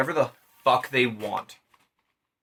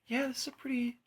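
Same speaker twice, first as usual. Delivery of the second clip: higher voice, like a laid-back dude; breezy higher-pitched dude voice sizing up a building site; casual Apollo; 9 semitones higher, faster than usual. Retold or imitated. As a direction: play the god as a chill surfer type. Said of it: "he's like".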